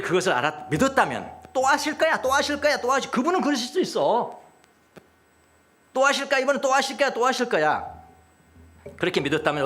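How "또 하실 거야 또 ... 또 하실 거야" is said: raised pitch, eager repeated hope; acted hopeful insistence; eager expectation. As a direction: sound sure it will happen again, voice lifted. Imagined believing disciples expecting another miracle.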